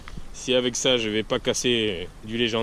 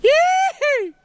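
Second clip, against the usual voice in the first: high loud squeal ringing in echo; hallway echo; 9 dB louder than usual. Real, not acted. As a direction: squeal into an echoing hall. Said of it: "ça résonne de dingue ici". (couinement aigu qui résonne)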